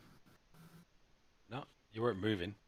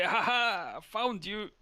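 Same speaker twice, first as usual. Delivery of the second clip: gleeful dwarf, higher and louder; dwarf catching up with the party, gleeful; triumphant dwarf; 11 semitones higher, 5 dB louder, slower than usual. Acted. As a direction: crow it like you've won hide and seek. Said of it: "he runs towards you and is like".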